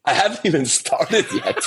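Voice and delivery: laughing through the words. Retold or imitated. Retelling his firing to a friend, laughing. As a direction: giggle out the irony of it. Telling friend he got fired.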